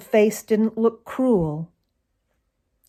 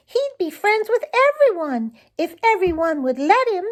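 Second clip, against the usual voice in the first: very high, bubbling bird voice; joyful chickadee. Acted. Tommy Tit the chickadee chirps his joy, very high. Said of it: "he cried".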